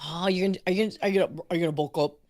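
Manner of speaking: normal talking voice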